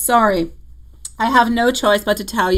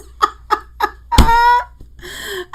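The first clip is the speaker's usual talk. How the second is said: high laugh; 14 semitones higher, 4 dB louder than usual. Real, not acted. Laughs at forgetting Italian.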